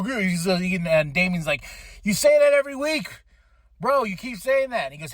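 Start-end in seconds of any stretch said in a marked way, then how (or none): 2.0-4.8 high, quick mock exasperation; imitating a fed-up friend calling someone out; exasperated retort. Acting out a backstage quarrel.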